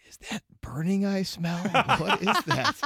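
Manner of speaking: a hint of a silly intimate voice, laughing; mock partner-ear voice. Mock murmur in a partner's ear, lightly laughing. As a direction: tease a playful whisper-voice gone wrong. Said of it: "Like a weird playful voice?".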